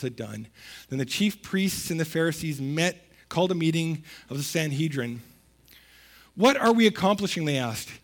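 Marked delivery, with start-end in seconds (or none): 6.3-7.4 alarmed question. Acted. Alarmed Pharisees quoted.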